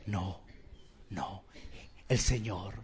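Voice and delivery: very soft, low reply after a question; low, reverent hush; hushed answer. Real, not acted. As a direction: drop almost to nothing, pausing between answers. Preacher answers own question softly.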